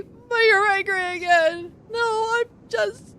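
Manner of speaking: high, crying voice; tearful accusation. Acted. Marn answers Wiki, high and tearful. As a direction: accuse her through tears.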